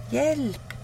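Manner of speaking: a small cat screaming for rescue; panicked cry for help. Acted. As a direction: cry out in terror from the boat. Scared cat cries for help.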